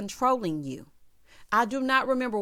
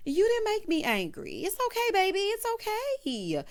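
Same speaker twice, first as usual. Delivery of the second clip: mock sweet Portia; 11 semitones higher than usual. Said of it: "she's like".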